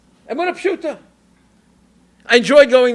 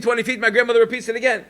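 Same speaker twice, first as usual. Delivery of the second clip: ordinary talk, nothing marked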